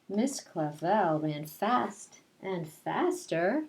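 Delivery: put-on reading a picture book aloud to children; lilting read-aloud, rhyme by rhyme; storytelling voice. Teacher reading Madeline to her class, storytelling voice.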